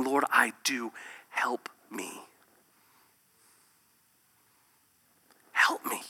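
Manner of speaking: hushed, fading cry for help; whispered plea. Acted. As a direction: breathe out the prayer softly.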